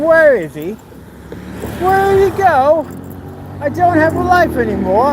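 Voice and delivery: high, drawn-out mock wail; hammed-up lament, stretched out and high; mock crying. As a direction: imitate a sad, abandoned fan whining. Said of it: "crying in the corner, thinking".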